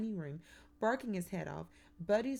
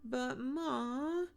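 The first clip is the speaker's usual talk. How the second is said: high, loud, drawn-out whine; whining; 8 semitones higher, 5 dB louder than usual. A narrator's reading. Son protests to his mother about bed, whining. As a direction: whine it in protest, pitch climbing. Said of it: "Kermit whines".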